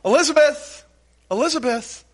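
high-pitched call, easing off on the repeat; Southern woman calling for her relative. Acted. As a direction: holler the name through the house. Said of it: "she calls out ... She had a southern accent".

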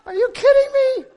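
high, loud laughing disbelief; exasperated squeal. Retold or imitated. Pastor cries out to God, high laughing disbelief. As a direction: throw it up to God in laughing outrage.

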